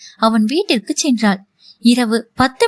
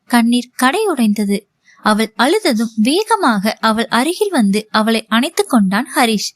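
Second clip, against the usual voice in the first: usual speaking voice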